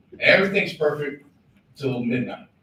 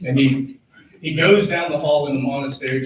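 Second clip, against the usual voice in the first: ordinary delivery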